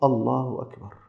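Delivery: soft, falling reply; hushed answer with a falling end; lightly voiced sheikh. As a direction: imitate a sheikh answering quietly, voice settling down. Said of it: "قال الشيخ".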